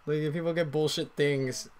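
ordinary delivery

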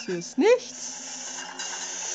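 slow whisper. Real, not acted. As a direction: breathe it out unvoiced. Hiding, whispering.

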